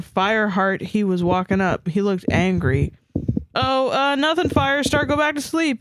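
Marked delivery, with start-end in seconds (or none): 3.6-5.8 high little mewed excuse; mewing. A narrator's reading. Starpaw hoping Firestar would leave, high mew. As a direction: brush him off in a raised kitten pitch. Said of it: "mewed Starpaw".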